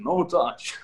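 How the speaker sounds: lightly voiced Russian dad accent; a hint of a stern Russian father. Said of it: "with the heavy Russian accent".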